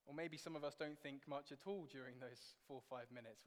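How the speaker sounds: ordinary talk, nothing marked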